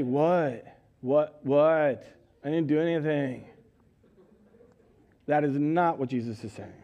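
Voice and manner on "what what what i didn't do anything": put-on lower, whiny defensive teen; sulky boy dodging blame; bratty kid. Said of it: "Jesus didn't answer his parents and say".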